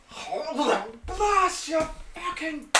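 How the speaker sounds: high, loud cursing outburst; startled yelling. Acted. Game master as man jolted awake, startled yelling. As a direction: yell it high, drawing out the curses.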